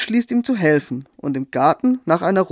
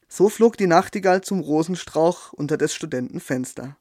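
ordinary delivery, nothing marked

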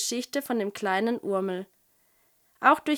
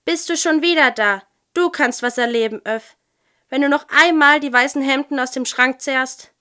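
loud, raised telling-off; cross, emphatic reprimand; indignant scolding; 3 semitones higher, 6 dB louder than usual. A narrator's reading. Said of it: "Wutz sprang auf und schnaufte".

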